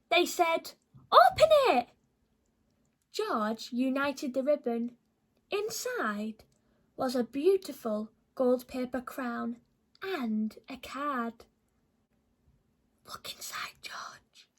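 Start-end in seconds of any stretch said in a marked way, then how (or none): 1.1-1.9 high, louder urging; eager animals. Acted. The animals urge George to open their present, eager and high. 13.1-14.5 soft secretive whisper; hushed whisper. Acted. The animals whisper to George to look inside the card.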